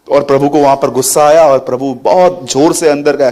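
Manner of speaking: ordinary delivery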